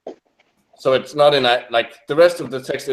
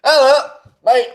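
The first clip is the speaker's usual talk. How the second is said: high, loud mock-Aussie greeting; Australian accent; 12 semitones higher, 5 dB louder than usual. Podcaster trying an Australian accent for the co-hosts, hammed-up. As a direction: call out a cartoonish Australian hello. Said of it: "hear that in an Australian accent".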